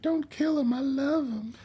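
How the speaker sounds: casual plea in a high voice; matter of fact. Retold or imitated. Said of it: "not pleading. It's just, like, matter of fact".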